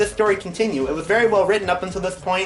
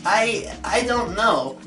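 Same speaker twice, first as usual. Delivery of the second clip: slow, halting stammer; 4 semitones higher, much slower than usual. A narrator's reading. Blossom baffled by the hug, stammering slowly. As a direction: stumble over the words in confusion. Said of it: "Blossom stammered".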